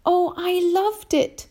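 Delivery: put-on very high, slow, airy child voice; delighted small boy. A narrator's reading. James answers the grasshopper, high delighted boy voice. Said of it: "James answered".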